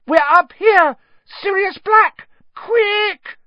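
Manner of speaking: shrieked, very high cries for help; screaming. A narrator's reading. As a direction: scream desperately to be heard downstairs. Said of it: "Hermione screamed suddenly".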